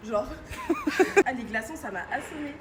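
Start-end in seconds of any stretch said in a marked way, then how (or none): 0.3-1.2 high shrieking laugh; shocked laugh. Real, not acted. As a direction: burst out in a squealing laugh. Laugh after icy water. (rire aigu)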